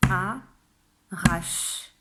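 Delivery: "arrache" split into a, rache with a long pause; two separate beats for "arrache", final e silent; syllable by syllable. Shown as a demonstration. Teacher counting spoken syllables, word split in two. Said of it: "Ça fait deux syllabes".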